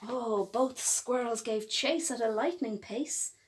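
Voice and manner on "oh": ordinary delivery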